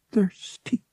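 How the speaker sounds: put-on high, feeble sick boy; struggling to speak. A narrator's reading. Feverish boy asking for water, struggling to speak. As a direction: croak out the word weakly, pitched high. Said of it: "Han struggled to speak".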